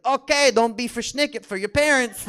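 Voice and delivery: loud, high Yiddish Santa; hammed-up, belted Yiddish Santa. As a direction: scold the child as a Yiddish-sounding Santa. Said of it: "a decidedly Yiddish Santa".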